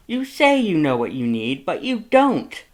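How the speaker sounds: high, loud, exasperated lecture; worried brother laying down the law; Papyrus scolding. A narrator's reading. Papyrus lectures Sans about drinking, high and loud.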